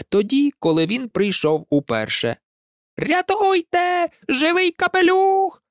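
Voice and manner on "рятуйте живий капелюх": very high, drawn-out hen squawk; clucking hens. A narrator's reading. Hens panic at a living hat, high cackling alarm. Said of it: "куткудакали кури".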